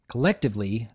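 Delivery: usual speaking voice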